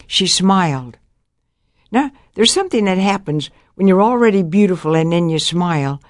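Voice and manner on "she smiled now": normal talking voice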